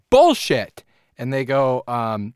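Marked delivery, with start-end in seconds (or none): 0.1-0.8 high, loud burst of disbelief; loud mock outburst. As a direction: call it out as the fed-up brewers. Brewers' reaction acted out, jumps loud.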